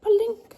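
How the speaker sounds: high, loud mock plink; high loud mock plink sound. Sound effect of crumb.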